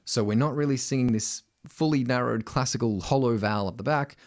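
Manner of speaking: ordinary talk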